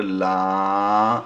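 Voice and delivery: লা with a long drawn-out a, very slow; লা stretched, as in Quran recitation; lengthened vowel. Shown as a demonstration. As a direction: hold the vowel of লা for a long beat. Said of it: "এটা লম্বা হবে".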